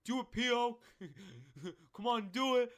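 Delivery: loud hyped shout, laughing; egging on. Retold or imitated. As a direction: egg your buddy on at full volume. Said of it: "he goes".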